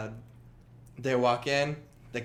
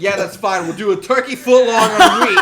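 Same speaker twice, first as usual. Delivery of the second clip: loud, raised, hammed-up irritation; exasperated order-taking; 8 semitones higher, 12 dB louder than usual. Sandwich maker repeating a late order, loud and exasperated. Acted impatient counter worker.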